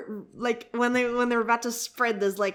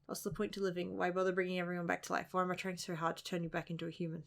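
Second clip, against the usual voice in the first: soft, quick read of brooding lines; manga dialogue reading; 12 dB quieter, faster than usual. Acted. Reader voicing a character questioning life, soft understated reading.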